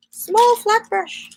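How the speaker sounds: high, slow sing-song murmur; sing-song to herself hunting for the brush. Pretend. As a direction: stretch it up high and slow like a little tune. Repeats brush name, searching.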